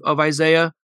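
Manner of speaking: usual speaking voice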